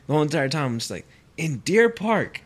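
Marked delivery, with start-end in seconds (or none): 1.4-2.4 high, drawn-out disbelief; incredulous question. Retold or imitated. Worker baffled by a drug house in Deer Park, high incredulous question. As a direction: stretch it out, pitched up in amazement. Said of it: "that's the part that I was like".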